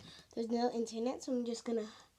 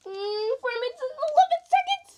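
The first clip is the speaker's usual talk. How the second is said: panicked high readout; 12 semitones higher, 6 dB louder than usual. Real, not acted. Reading timer in panic.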